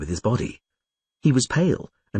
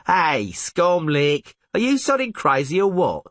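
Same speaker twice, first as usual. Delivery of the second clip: a drawn-out, higher mocking "Hey"; rough soldier; 9 semitones higher than usual. A narrator's reading. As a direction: jeer at him like a drunk mercenary. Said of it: "interrupted Vökter".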